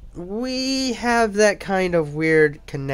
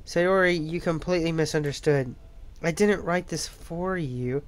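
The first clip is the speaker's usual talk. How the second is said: ordinary talk, nothing marked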